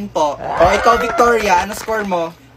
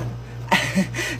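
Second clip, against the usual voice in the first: sly giggle; 4 semitones lower, 7 dB quieter than usual. Acted. Teasing friend over a secret boyfriend.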